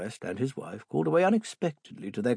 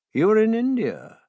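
Holmes voice; 11 semitones higher, 7 dB louder than usual. Acted. Holmes questions Maiden sharply.

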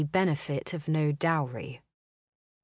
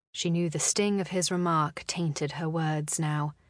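ordinary delivery

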